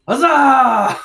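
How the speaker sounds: high, loud whooping cheer; celebratory cheer. Acted. Slug villager celebrating victory over the farm conglomerate, shouted cheer. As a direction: let the huzzah ring out long.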